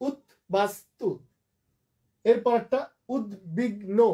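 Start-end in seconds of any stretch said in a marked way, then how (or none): 0.1-1.1 উদ্বাস্তু slowly as ud, bas, tu; syllable by syllable. Shown as a demonstration. Teacher telling students how to write, syllable by syllable. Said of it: "অক্ষরটা ধরে ধরে". 3.1-4.0 উদ্বিগ্ন slowly as ud, big, no; syllable by syllable. Shown as a demonstration. Teacher dividing the next example, syllable by syllable. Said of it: "তিনটা".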